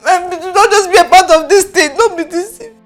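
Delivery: tearful begging; crying. Acted. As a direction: keep pleading through the sobs. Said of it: "Stop crying".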